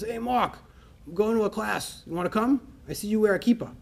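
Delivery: put-on a friend inviting him along, lightly in Staten Island accent. Friend Marissa inviting him to a class, light Staten Island accent. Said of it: "I can do a little Staten Island accent".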